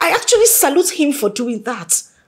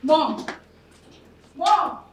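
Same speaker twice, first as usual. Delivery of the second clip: calling out; 6 semitones higher, 4 dB quieter than usual. Acted. Daughter calls after leaving mother.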